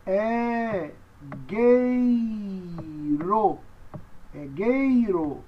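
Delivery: long-held syllables in "gueiro"; slow model for learners to copy; pronunciation demo. Shown as a demonstration. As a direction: stretch "gueiro" with a hard g. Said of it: "Então, você diz é gueiro".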